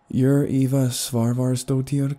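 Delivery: sweet American voice. A narrator's reading. Rose checks the patient's name, sweet and slow. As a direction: ask sweetly in an American accent, slowly. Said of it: "deceptively sweet voice".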